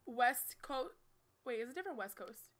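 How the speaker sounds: usual speaking voice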